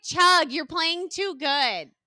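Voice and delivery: high, loud, drawn-out egging on; mock-goad him loudly and slowly; very loud imitation. She urges him to chug his wine, loud imitation. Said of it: "like, very loud on the flight".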